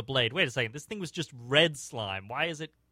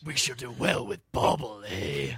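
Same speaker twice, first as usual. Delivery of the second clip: Batman. Acted. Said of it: "a Badnass Grignir accent".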